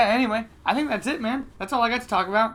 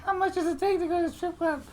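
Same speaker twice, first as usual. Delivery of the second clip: put-on much higher, thin young voice; mock high-pitched teenage voice; high-pitched girlish voice; 11 semitones higher than usual. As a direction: squeak it out like a shy teen. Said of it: "my high-pitched voice at nineteen".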